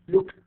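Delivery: "looked" ending in T; final T sound. Shown as a demonstration. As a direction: say "looked" as one syllable, ED as T. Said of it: "I pronounce E D as T".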